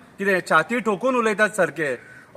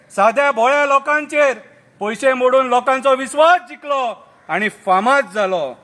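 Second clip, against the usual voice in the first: satirical poem recital; 6 semitones higher, 4 dB louder than usual. Acted. Reciting the turncoats' confession to friends, declaimed verse.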